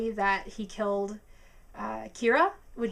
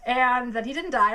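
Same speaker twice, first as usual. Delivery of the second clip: ordinary talk, nothing marked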